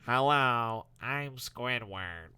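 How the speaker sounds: slow, bored nasal drawl; Squidward voice. Retold or imitated. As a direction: drag it out like a weary Squidward. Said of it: "Squidward sounded like SpongeBob impression of Squidward".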